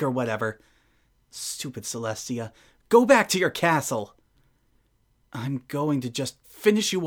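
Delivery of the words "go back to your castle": resentful outburst. Acted. Resentful outburst at the rival princess.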